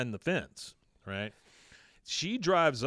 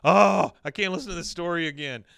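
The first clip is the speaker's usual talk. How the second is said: loud, high mock exasperation; acted-out protest; exasperated groan; 5 semitones higher, 10 dB louder than usual. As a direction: groan it like a fed-up kid. Acting out Bobby's exasperation.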